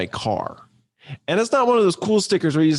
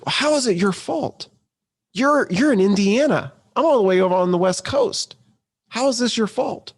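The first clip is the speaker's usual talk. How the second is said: high, disbelieving questions; incredulous caller; 7 semitones higher than usual. Retold or imitated. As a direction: imitate a stunned customer who can't see the blame. Caller baffled by the apology.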